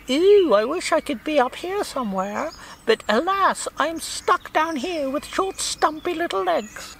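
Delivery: high-pitched, drawn-out comic falsetto; silly Monty Python falsetto, slowing as it goes. Acted. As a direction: squeal a hammed-up Python-style lament, very high. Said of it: "Going on a bit of a Monty Python thing".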